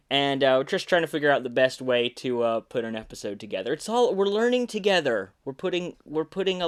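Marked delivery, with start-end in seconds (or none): none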